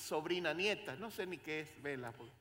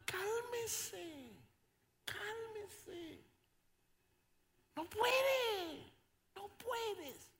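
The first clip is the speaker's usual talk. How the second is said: put-on high childlike voice, loud then fading; little girl voice; 13 semitones higher, 14 dB louder, much slower than usual. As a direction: play the granddaughter soothing grandpa.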